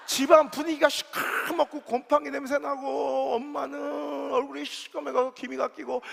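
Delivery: half-crying comic lament for a house of sons; mock wailing lament. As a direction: wail it like a hammed-up dirge. Mock lament over three sons.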